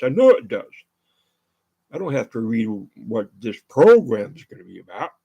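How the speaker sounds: normal talking voice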